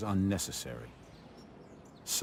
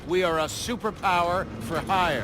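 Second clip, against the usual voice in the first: loud, raised presentation voice addressing a crowd; commanding keynote speech, louder and higher; formal sales pitch; 12 semitones higher, 7 dB louder than usual. Acted. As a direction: project it like a CEO showing off his army. CEO presents Atlas to recruit.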